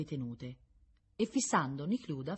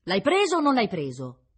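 loud, raised yell through the crowd; high, forceful shouting; 8 semitones higher, 11 dB louder than usual. A narrator's reading. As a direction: shout it across to the other side. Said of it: "gridava qualcuno dall'altra parte".

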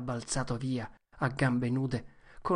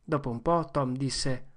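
ordinary delivery, nothing marked

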